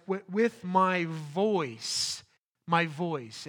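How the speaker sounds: "with my voice" said slowly, leaning on "voice"; stress on voice. Shown as a demonstration. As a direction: drag out "with my voice", hit "voice". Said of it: "It's literally his voice".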